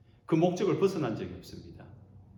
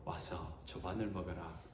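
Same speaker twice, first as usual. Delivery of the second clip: literary gentle invitation; 7 semitones lower, 14 dB quieter than usual. Acted. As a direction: invite them in softly, like a kind host. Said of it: "이렇게 문학적으로". (부드럽고 느린 문어체 초대)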